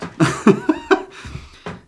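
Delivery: breathy, high burst of laughter; delighted laugh. Real, not acted. Delighted by Shakespeare's line.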